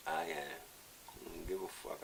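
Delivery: usual speaking voice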